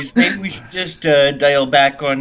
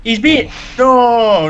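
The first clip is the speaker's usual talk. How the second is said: emphatic protest; 7 semitones higher, 4 dB louder, much slower than usual. Real, not acted. Protesting hoser label.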